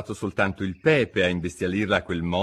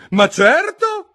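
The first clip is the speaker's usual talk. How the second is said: much higher, loud, with a laugh; laughing duchess; 10 dB louder, much slower than usual. A narrator's reading. Duchess laughs her agreement, high and loud. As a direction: chuckle with smug delight.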